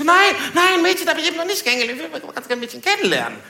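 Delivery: high, hurried mock-eager voice; agitated reply. Newcomer telling his bro he wants a girl, high and hurried.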